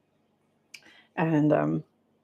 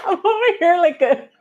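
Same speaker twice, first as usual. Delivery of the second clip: high, loud voice breaking into laughter; laughing to tears; 11 semitones higher, 4 dB louder than usual. Real, not acted. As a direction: barely get it out through giggles. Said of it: "they're making me cry".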